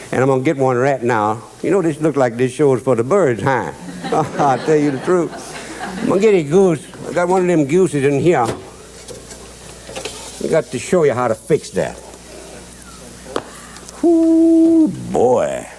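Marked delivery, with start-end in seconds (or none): 3.8-4.3 soft breathy chuckle. Real, not acted. Chuckle at own joke. 14.1-14.9 a sudden high, loud ooh of delight. Real, not acted. Reacting to the goose.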